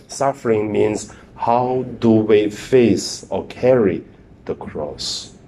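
normal talking voice

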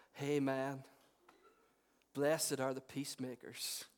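lightly voiced laid-back drawl, low and slow; slow, low, easygoing hippie guru impression; Owen Wilson. Preacher imitating Jesus as a wandering guru, lightly voiced Owen Wilson accent. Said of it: "That's my best Owen Wilson accent".

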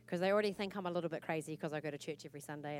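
usual speaking voice

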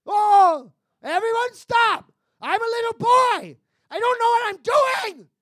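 a hint of a little boy, loud. Crossing guard boy yells at the street, mildly childlike and loud. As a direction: shout it like a flustered kid crossing guard. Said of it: "a little boy who's a crossing guard".

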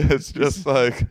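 ordinary delivery

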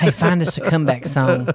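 teasing copy of the co-host; laughing mock impression; mocking imitation of co-host. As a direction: mimic your co-host to tease. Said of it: "Did I say it like that? Do I sound like that?".

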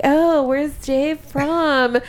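imitating a polite, drawn-out question; polite inquiry. Acquaintances asking the wife about Dave, mock polite. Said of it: "they try to be all polite".

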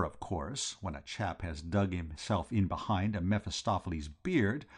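ordinary delivery

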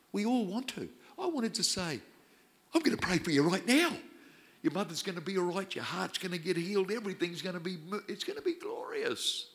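high, loud, overeager pretend prayer; mock gushing promise. Preacher mocking his own urge, high and gushing. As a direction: ham up the zealous fixer.

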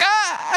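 high yelp of pain; a sharp high cry as she drops. Retold or imitated. Sister cries out in pain.

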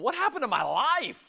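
high-pitched, distraught wail; hammed-up "my life is ruined" voice; mock dismay. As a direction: cry out in exaggerated bewilderment. Voicing a dismayed new believer.

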